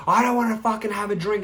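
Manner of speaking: high-pitched, hammed-up argument; mock yelling. Drinker arguing back at friends, mock yell. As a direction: imitate a heated refusal without full volume. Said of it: "you're yelling at each other".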